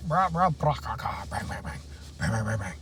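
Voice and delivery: soft rapid-fire mouth noises, falling away; playful imitated noises. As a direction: let the word dissolve into mock gunfire, quietly. Riffing on a greeting into noises.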